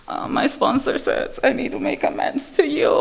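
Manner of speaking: half-whispered, sheepish; wife sheepishly confessing to her husband, whispered; sheepish confession. Retold or imitated. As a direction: confess it meekly, almost whispering.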